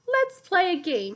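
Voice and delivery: a hint of a sick donkey inviting a game; mildly raspy villain, playful; French donkey with sore throat. A narrator's reading. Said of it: "French donkey with a sore throat voice".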